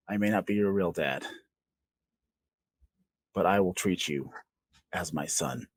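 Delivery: tender promise. Acted. Tender old man's promise.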